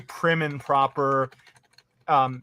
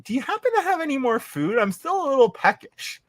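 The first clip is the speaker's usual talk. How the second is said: sweetly asking for more; 9 semitones higher than usual. Retold or imitated. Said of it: "so I'm like".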